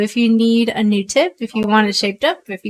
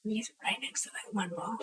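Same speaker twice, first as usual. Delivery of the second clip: soft hushed whisper; 18 dB quieter than usual. Real, not acted. Watching the pool shot, hushed whisper. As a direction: whisper so the player can't hear. Commentary during a tense shot.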